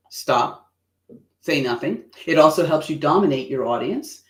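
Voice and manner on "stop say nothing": blank deadpan. Shown as a demonstration. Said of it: "it can be like".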